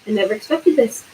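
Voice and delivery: ordinary delivery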